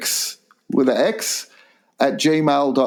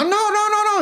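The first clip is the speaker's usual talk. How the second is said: high mock pleading; faster than usual. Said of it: "They go".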